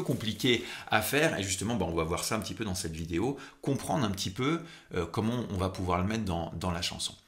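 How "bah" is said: usual speaking voice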